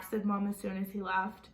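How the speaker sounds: ordinary delivery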